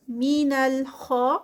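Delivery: ordinary delivery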